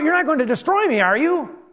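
high, pleading mock cry; demon crying out. Preacher plays the demon facing Jesus, crying out. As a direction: voice a demon afraid of being destroyed. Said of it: "And he cries out".

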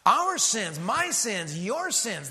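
"Our", "my", "your" each punched loud and high; emphasis on sins. Shown as a demonstration. Pressing the point on the congregation with strong stress. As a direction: drive each "sins" home, rising in force. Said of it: "That's the emphasis here".

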